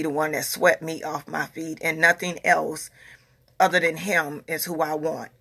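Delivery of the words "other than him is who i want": usual speaking voice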